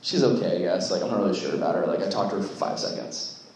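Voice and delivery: put-on a teenager who can't be bothered; boy shrugging off a camp crush to his friends, low and unsure; lightly voiced unsure guy. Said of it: "The guy's like".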